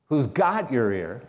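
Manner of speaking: GOT drawn out and leaned on, slowly; stress on got. Shown as a demonstration. Preacher questions the congregation word by word, stress on got. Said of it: "A different emphasis on different words".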